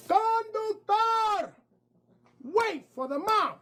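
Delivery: woman shouting at the bus driver to wait; calling out. Acted. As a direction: call to the front of the bus. Lady calls out to driver.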